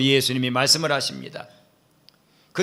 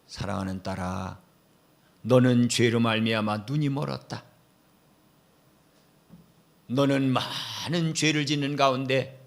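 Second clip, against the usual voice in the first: tender; 2 semitones lower, 4 dB quieter than usual. Acted. Jesus speaking to his beloved daughter, tender and soft. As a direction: speak softly like a loving father. Jesus addressing sinful woman.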